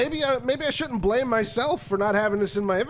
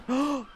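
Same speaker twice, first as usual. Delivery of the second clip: gasp; 4 semitones higher, 4 dB quieter than usual. Real, not acted. Sees creatures still there.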